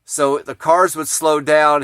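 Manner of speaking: ordinary talk, nothing marked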